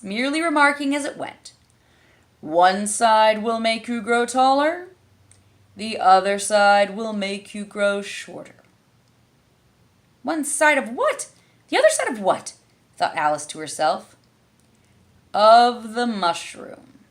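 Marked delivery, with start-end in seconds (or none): 2.4-8.6 slow, drawn-out louder caterpillar. A narrator's reading. Caterpillar remarks leaving. 10.2-12.5 put-on much higher bewildered girl; genuinely baffled; Alice puzzled. A narrator's reading. 15.3-17.1 slow, drawn-out louder caterpillar. A narrator's reading. Caterpillar answers.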